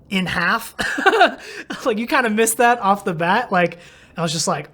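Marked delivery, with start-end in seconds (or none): none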